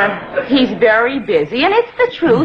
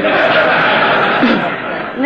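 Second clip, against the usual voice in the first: breathy unvoiced laugh; a short mocking laugh; 3 semitones lower than usual. Mother laughing at son's excuse, breathy chuckle.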